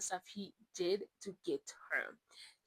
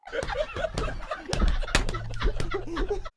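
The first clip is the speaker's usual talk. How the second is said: loud high laugh; 9 dB louder than usual. Real, not acted. Big laugh heard.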